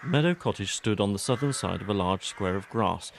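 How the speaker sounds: plain reading voice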